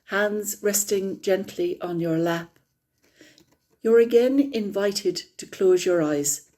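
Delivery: calm, unhurried meditation guide; guided meditation. Acted. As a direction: ease the group gently into stillness.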